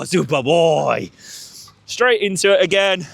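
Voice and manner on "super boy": praise. Real, not acted. Praising the dog.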